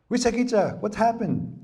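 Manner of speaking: concerned little squirrel, pitched high. Retold or imitated. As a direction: squeak a worried question. Squirrel asks what happened.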